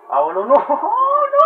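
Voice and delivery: high, loud gamer's wail; dismayed wail. Real, not acted. Gamer reacting to a bad turn in the match, dismayed wail. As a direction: let a loud 'no' shoot way up in pitch. Alarmed cry while playing the game.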